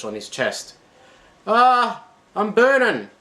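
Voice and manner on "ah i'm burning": very high, loud cry of burning pain. Acted. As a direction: yelp it as the flame hits. Burned by the blowtorch.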